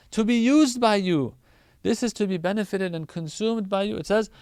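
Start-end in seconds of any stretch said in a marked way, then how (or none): none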